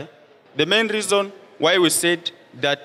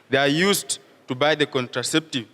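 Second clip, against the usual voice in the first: normal talking voice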